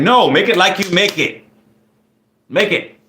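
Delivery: insistent. Retold or imitated. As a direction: insist on your order, playfully firm. Retelling her insistence to the cook.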